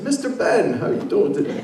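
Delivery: high, airy imitation of a cheerful friend; lightly mocking friendly greeting; Sandy impression. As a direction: chirp it like Sandy greeting you. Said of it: "That's my Sandy impersonation".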